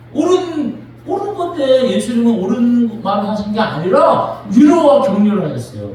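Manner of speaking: ordinary talk, nothing marked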